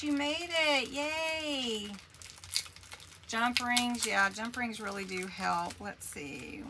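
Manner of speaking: ordinary talk, nothing marked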